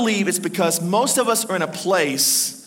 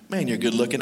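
low, soft admiring murmur to oneself; dropped-voice mock vanity; smug self-compliment; 10 semitones lower, 5 dB quieter than usual. Husband talking to himself in the mirror, smug.

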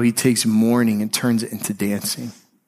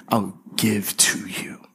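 whispered, slow promise; tender whisper; 3 semitones lower, 4 dB quieter, slower than usual. Retold or imitated. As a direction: breathe it softly, like a secret comfort. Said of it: "he means it when he says".

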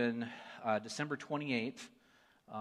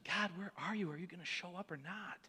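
retold pleading prayer; 7 semitones higher than usual. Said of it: "it's just like".

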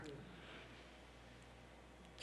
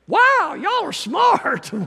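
much higher, slow mock-amazed shout; hammed-up fake awe at the scholars; sarcastic shout; 14 semitones higher, 5 dB louder, much slower than usual. Preacher mocking the theologians with a slow, high sarcastic shout.

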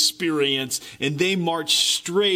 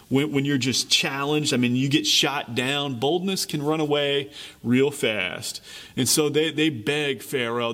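normal talking voice

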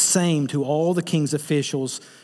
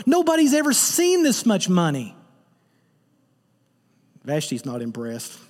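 normal talking voice

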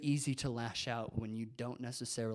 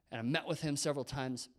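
normal talking voice

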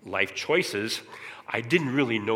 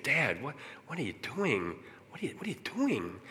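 softly voiced son questioning dad; hushed, incredulous son's plea; exasperated son; 3 semitones higher, 6 dB quieter than usual. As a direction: imitate a grown son quietly challenging his father. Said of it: "came to me and said".

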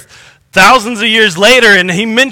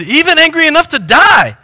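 sharp retort, higher; 7 semitones higher than usual. A narrator's reading. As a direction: fire it back defiantly. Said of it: "Jonah retorted".

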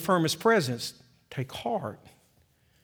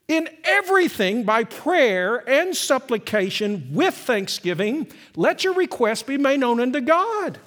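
louder, proclaimed scripture; fervent scripture reading; 8 semitones higher, 5 dB louder than usual. Acted. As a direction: proclaim the verse to the congregation. Reads Philippians with fervor.

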